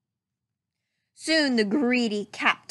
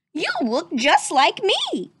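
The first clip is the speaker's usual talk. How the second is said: high, loud astonished cry; cried out; 6 semitones higher, 4 dB louder than usual. Acted. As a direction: gasp it out amazed. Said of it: "cried Mickey".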